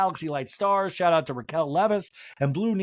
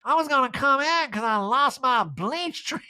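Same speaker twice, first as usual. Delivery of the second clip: Erica impression; 9 semitones higher than usual. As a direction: drag out a lost, high calling voice. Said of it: "And Erica yells".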